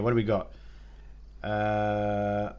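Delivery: ordinary talk, nothing marked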